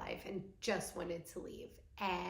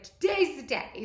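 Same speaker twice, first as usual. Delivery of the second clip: psyching up; 6 semitones higher, 7 dB louder than usual. Retold or imitated. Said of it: "I was like".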